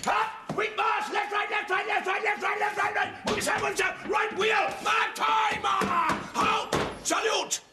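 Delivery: high barked parade commands; drill shout. Acted. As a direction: bark it across the parade ground. Sergeant major drilling marchers.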